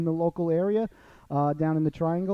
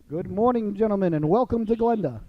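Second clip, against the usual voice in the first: higher, louder showman welcome; radio host greeting; 6 semitones higher, 4 dB louder than usual. Acted. Host welcoming the coaches onto the show, bright announcer voice.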